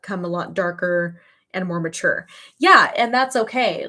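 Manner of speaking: ordinary talk, nothing marked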